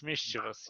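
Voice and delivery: "mischievous" with first-syllable stress, said softly. Shown as a demonstration. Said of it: "the accent is on the first syllable".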